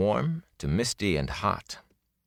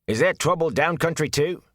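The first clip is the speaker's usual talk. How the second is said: higher, abrupt attendant's voice; abrupt question; 10 semitones higher than usual. Acted. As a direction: blurt it out suddenly. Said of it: "he said abruptly".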